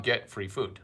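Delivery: ordinary talk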